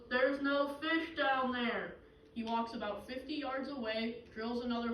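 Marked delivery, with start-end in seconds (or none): 0.1-2.0 slow, loud heavenly announcement; voice from above. Acted. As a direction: proclaim it from on high, stretching the words. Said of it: "Again, the voice goes".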